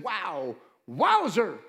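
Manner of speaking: drawn-out, swooping wow of mild awe; mock amazement. Preacher voices Jesus impressed by people's faith, mock amazement. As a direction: lightly play up being impressed.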